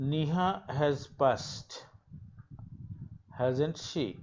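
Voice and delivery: slow read with the voice rising on "hasn't she?"; an unsure question, rising at the end; rising intonation. Shown as a demonstration. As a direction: stretch "Neha has passed" slowly, then lift the tag "hasn't she?" upward. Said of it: "এখানে rising intonation হয়েছে".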